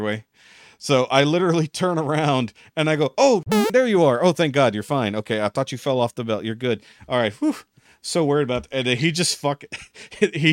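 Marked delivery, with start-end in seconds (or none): 3.2-7.5 mock relieved surprise. Covering for trash talk, gushing with fake relief. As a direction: gush with put-on relief, as if he'd fallen.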